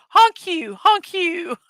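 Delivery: high-pitched honking pun; joking dad man. Retold or imitated. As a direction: honk it out high like a silly catchphrase. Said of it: "dad man would probably say".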